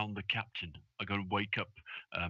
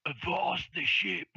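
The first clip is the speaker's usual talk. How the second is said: higher, drawn-out sailor's hail; calling out; 7 semitones higher, 4 dB louder, slower than usual. Acted. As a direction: call slowly into the fog. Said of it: "calls out into the fog".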